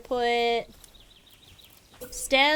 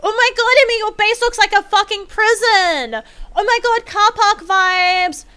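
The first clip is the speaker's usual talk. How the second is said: much higher, louder mock disgust; mocking critic; 8 semitones higher, 8 dB louder than usual. Imitating friends mocking her base as a prison, mock disgust. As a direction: sneer at the base like a snobby friend. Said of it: "it was".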